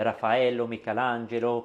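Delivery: names said the Italian way; Italian pronunciation. Shown as a demonstration. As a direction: give the names an Italian accent. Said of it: "comme on dit en italien". (prononciation à l'italienne)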